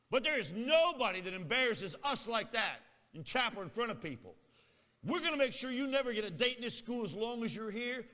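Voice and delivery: put-on high, loud, indignant girl scolding him; angry girl. The spokesgirl threatens him outside chapel, angry and high. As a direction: tear into him like an offended student.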